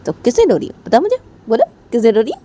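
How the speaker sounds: high-pitched, pressing questions; acted impatient prodding; insistent questioning. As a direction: demand an answer, voice jumping up. Sonam presses Poonam for the reason.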